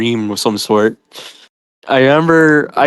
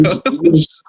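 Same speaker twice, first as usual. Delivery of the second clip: high, begging please please; whiny begging parody; pleading mock; 12 semitones higher than usual. Mocking a warning notice.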